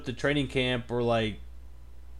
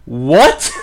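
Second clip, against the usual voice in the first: shocked; 2 semitones higher, 14 dB louder than usual. Real, not acted. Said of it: "reacting to a dead player".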